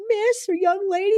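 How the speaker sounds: high mock-polite customer. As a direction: pitch it up like a chirpy clerk-side greeting. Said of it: "Or, like".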